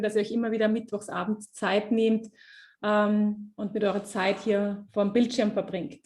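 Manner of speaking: normal talking voice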